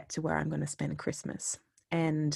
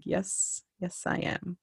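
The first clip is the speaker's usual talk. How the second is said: softer, sheepish yes; soft sheepish admission; 3 semitones higher, 7 dB quieter, slower than usual. Retold or imitated. A woman quietly agrees she's a freak, sheepish. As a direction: own it quietly.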